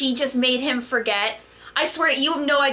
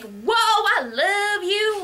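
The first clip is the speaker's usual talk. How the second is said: mock gushing lover; 7 semitones higher, much slower than usual. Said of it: "it was very, like".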